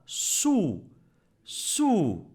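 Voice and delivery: "sou" alone, long falling vowel, then repeated; two separate drawn-out "sou", teacher modelling a syllable; isolated first syllable. Shown as a demonstration. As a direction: stretch each "sou" out on its own. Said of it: "dans la première syllabe".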